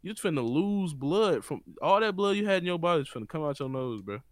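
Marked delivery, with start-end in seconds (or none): none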